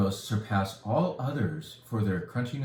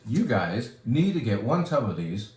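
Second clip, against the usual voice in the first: ordinary talk, nothing marked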